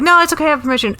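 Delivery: high, chirpy no it's okay; breezy brush-off. Retold or imitated. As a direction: sweetly dismiss the challenge. Rehearsed brush-off line.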